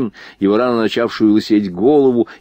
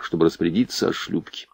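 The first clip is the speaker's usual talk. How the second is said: ordinary delivery, nothing marked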